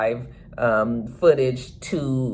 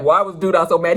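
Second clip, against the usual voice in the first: ordinary talk, nothing marked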